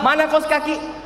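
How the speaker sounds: high-pitched impatient demand; mock a husband demanding his things; acted crossness without extra volume; angry husband. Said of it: "Suami mau marah".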